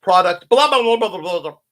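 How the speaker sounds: loud dismissive blah blah; mock ad babble. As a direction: rattle off the sales pitch. Mocking an ad pitch.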